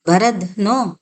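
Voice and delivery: ordinary delivery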